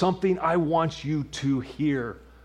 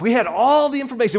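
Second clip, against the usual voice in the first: much higher and louder; emphatic repeat; 12 semitones higher, 11 dB louder, faster than usual. Real, not acted. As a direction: lift it, pleading. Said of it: "if we had all the information".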